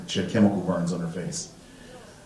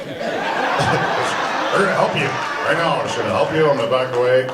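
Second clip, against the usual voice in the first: naive suburban voice; 4 semitones higher than usual. Retold or imitated. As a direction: play the naive suburb kid. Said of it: "I'm so suburbs".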